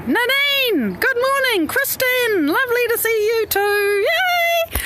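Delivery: high, sing-song welcome; excited greeting. Real, not acted. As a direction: greet them in a high, drawn-out cheer. Greeting people as they join.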